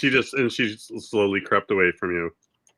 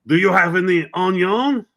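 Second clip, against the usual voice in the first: mimicking French customer; 10 semitones higher, 4 dB louder than usual. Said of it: "and he was like".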